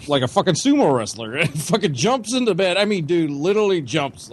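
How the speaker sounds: ordinary talk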